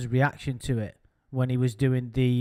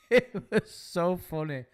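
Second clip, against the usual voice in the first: slow words through real laughter; laughing; 4 semitones higher than usual. Real, not acted. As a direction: laugh it out, still amused. Laughing at the drunken rant.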